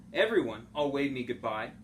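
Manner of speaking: usual speaking voice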